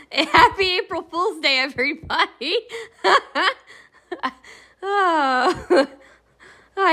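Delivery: laughing out the greeting. Real, not acted. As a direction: giggle through the words. Laughing after the prank.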